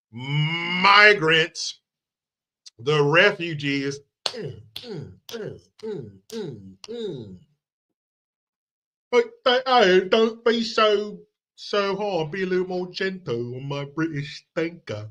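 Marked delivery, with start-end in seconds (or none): 4.2-7.4 mock moaning. Mocking the sound of bending over. 9.1-15.1 mock pleading Brit. Mocking a British victim.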